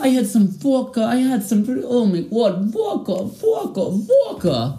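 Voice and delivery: high-pitched cartoon Russian accent. Shown as a demonstration. Host showing off about vodka, high mock Russian accent. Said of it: "this is my Russian accent".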